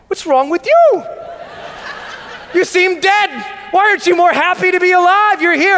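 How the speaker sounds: high, loud rallying yell; exuberant, hammed-up challenge; acted fired-up retort. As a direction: shout it back at the gloomy friends. Acts out his fired-up reply.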